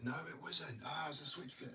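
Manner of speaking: high, mock-indignant bickering voice; mock skater argument. Skater insisting to another skater about a kickflip, high mock squabble. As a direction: play both sides of a petty trick argument.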